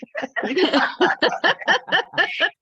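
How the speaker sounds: high, loud burst of laughter; real laugh. Real, not acted. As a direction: laugh out loud, caught off guard. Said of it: "had difficulty recognizing the voice of God".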